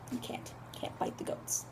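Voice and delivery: hushed, barely voiced caution; very soft mock-solemn whisper; whispered warning. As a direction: whisper it as a grave secret. Mock grave whispered moral.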